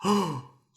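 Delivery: sharp surprised gasp; giant gasp. A narrator's reading. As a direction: gasp in shock. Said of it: "The giant gasped".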